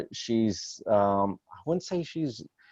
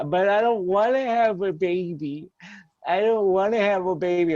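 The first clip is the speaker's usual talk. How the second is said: high whiny kid wail, imitated; hammed-up tearful boy, much higher; mock bawling child; 10 semitones higher, 3 dB louder than usual. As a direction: imitate a seven-year-old crying in protest. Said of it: "He started bawling".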